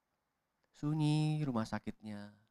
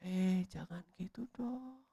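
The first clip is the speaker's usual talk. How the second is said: softer and slow, like a silenced voice; muffled plea; 7 semitones higher, 6 dB quieter, slower than usual. Acted. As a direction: plead weakly as if gagged. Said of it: "Seperti dibungkam gitu".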